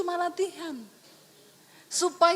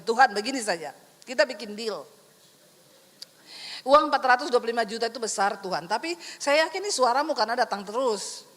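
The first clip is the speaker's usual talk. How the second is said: lower, bargaining plea to God; 6 semitones lower than usual. Retold or imitated. Preacher bargaining with God over the car, lower plea. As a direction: strike a deal with the Lord. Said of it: "saya bilang begini".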